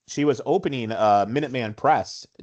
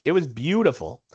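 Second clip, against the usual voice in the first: ordinary delivery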